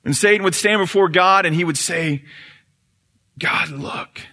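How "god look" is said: whispered plea. Acted. Satan pleading for justice.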